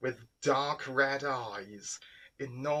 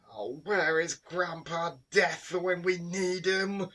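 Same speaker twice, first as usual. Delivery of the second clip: groaning; 6 semitones higher than usual. A narrator's reading. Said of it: "he groaned".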